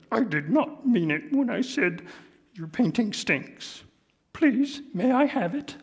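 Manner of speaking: high sorry puppet voice; apologetic Grover. Acted. Grover apologizing to Big Bird, high sorry voice. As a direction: ask nicely. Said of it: "letting me do the voices".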